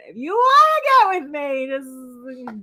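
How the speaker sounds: high, loud mock Italian accent; bad Italian accent. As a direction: flirt in a hammed-up Italian accent. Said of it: "Just, like, a bad Italian accent".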